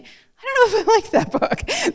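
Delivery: high, slow, laughing voice; a giggling, puzzled reader; amused high quote. Retold or imitated. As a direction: lift way up and laugh through the doubt. Quoting a reader's thought, laughing.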